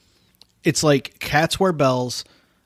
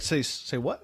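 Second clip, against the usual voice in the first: chewing while talking; mouth full; 10 dB quieter, slower than usual. Real, not acted. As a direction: say it through a cracker. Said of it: "I was chewing a wheat thin".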